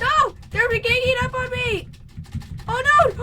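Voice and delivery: loud high panicked cries; real panic, shouting. Real, not acted. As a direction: yell out in real alarm. Panicking in fight in game.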